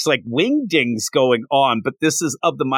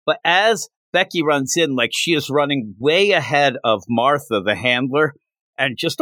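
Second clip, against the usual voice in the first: ordinary talk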